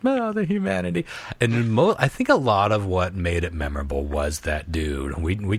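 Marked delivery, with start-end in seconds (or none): 0.1-1.1 imitating a distraught announcer, much higher; lightly mock-anguished cry, pitched up; emotional newsreel announcer. Quoting the Hindenburg reporter, mock-emotional outcry. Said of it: "his incredibly emotional and unprofessional".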